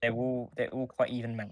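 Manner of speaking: ordinary talk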